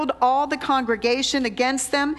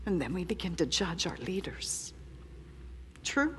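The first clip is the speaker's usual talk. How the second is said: soft, near-whispered admission; softly confessing own failings to the church; hushed confession; 3 semitones lower, 7 dB quieter than usual. Real, not acted. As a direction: confess it quietly, heart exposed. Quiet confession to the congregation.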